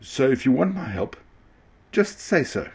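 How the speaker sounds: high, pointed mild annoyance; a little annoyed. A narrator's reading. Small train mildly annoyed at the rude ringmaster. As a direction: put him in his place lightly. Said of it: "a little annoyed now".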